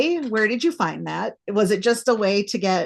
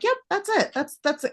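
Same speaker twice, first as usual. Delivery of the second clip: much higher, sure of herself; triumphant reader's guess, pitched way up; smug certainty; 11 semitones higher than usual. Retold or imitated. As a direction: declare you've solved it. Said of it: "and I'm like".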